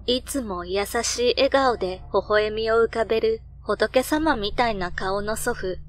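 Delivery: ordinary delivery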